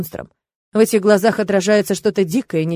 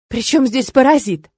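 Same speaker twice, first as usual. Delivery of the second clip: raised, indignant question; 4 semitones higher, 4 dB louder than usual. A narrator's reading. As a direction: demand it in mild outrage. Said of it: "возмутилась я".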